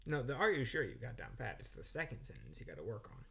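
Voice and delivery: usual speaking voice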